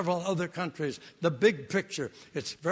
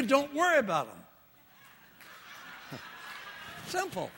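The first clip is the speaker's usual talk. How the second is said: chuckling it out, airy; laughing; 6 semitones higher, 4 dB quieter, slower than usual. Real, not acted. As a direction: laugh as you wave it off. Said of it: "laughing while speaking".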